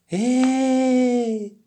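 long, very high, loud held cry; high holler. A narrator's reading. Turtle vanishing into the clouds, high holler. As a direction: let it ring out as you soar. Turtle calling while shooting skyward.

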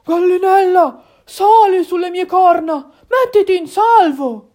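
loud, high urgent call; startled deer calling out loudly; exclaiming alarmed deer. Acted. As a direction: shout to get a friend to safety. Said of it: "spalancò gli occhi ed esclamò".

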